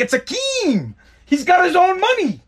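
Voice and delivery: over-the-top high-pitched movie holler; movie quote impression. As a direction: crow it high, stretching the last word. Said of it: "because coming to America".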